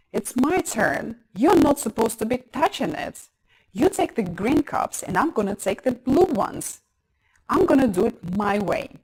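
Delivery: put-on loud, insistent squabbling child; fighting voice. Shown as a demonstration. Child claiming a turn in a game, fighting voice. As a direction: stake your claim loudly in a game. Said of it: "If you notice your child using a fighting voice".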